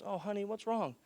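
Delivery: gently ask, as a worried secretary would; concerned secretary. Retold or imitated. Secretary reacts to his distressed look.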